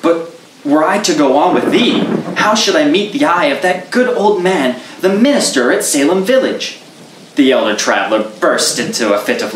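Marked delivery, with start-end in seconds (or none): 0.0-6.8 put-on higher, worried young Puritan; young man's anxious voice. Goodman Brown pleading with the traveler, raised and anxious. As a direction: fret about the minister's eye.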